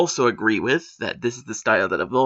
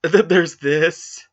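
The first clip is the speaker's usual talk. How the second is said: ominous dread; 4 semitones higher, 5 dB louder, slower than usual. Real, not acted. Presenting a bad example.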